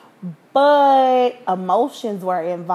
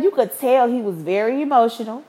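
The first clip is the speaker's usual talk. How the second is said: ordinary talk, nothing marked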